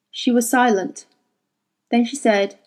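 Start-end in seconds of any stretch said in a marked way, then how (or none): none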